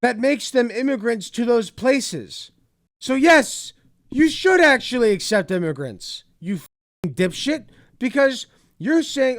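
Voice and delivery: high, loud exasperated yelling; furious rant. Real, not acted. Vlogger yelling at the clip's speaker, furious and loud. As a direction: blast him down, fed up.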